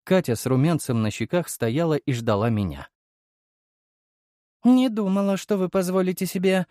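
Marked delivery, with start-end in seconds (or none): 4.6-6.7 высокий кокетливый девичий голос; притворно скромно, высоко; наигранно смущённо. Acted. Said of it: "наигранно опустила глазки девушка". (наигранно смущённая девушка)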